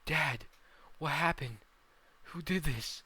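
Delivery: high, loud and urgent; alarmed son. Acted. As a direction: cry out in alarm to a wounded father. Son finds father badly hurt.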